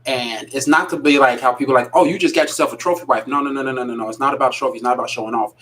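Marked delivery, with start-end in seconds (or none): none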